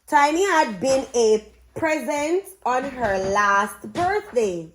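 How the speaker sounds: ordinary talk, nothing marked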